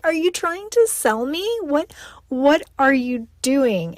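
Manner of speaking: high, incredulous and slowing down; startled disbelief. Retold or imitated. To mom, shocked she's trying to sell her, incredulous. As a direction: pretend to be shocked she'd sell you. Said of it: "I was like".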